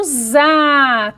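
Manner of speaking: "za" with a voiced Z and long vowel; som de Z. Shown as a demonstration. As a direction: draw out "za" slowly, buzzing Z. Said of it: "Tem som de Z". ("za" com som de Z, bem alongado)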